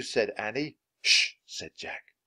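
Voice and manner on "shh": unvoiced hushing hiss; quick warning "shh"; sharp shush. A narrator's reading. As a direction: shush a friend urgently. Jack hushes Annie.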